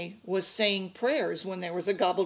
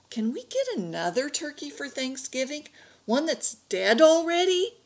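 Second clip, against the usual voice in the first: high-pitched hopeful question, rising; worried plea; 7 semitones higher than usual. A narrator's reading. Worried boy's pleading question to Papa.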